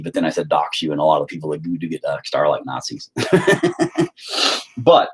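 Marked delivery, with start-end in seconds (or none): 3.1-4.7 a high burst of laughter. Real, not acted. Man laughing at his own joke about doxing, in a high burst of laughter. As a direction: crack up at your own remark.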